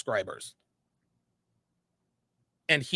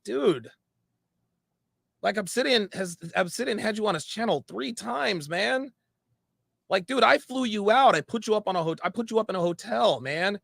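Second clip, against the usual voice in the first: higher-pitched exasperated appeal; retold remonstrance; 7 semitones higher than usual. Host recalling his protest to a friend he gave a platform, retold remonstrance. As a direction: retell your protest in a raised pitch. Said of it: "And I'm like".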